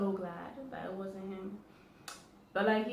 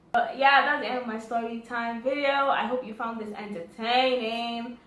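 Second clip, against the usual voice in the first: louder, upbeat sign-off; upbeat vlog outro; 2 semitones higher, 7 dB louder than usual. Real, not acted. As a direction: wrap up the video for viewers.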